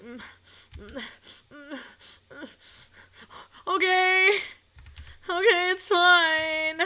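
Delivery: hesitant hums, then a slow strained okay; forced okay. Retold or imitated. Shy girlfriend forcing herself to agree, strained okay. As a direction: swallow the objection and agree. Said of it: "didn't have the candor".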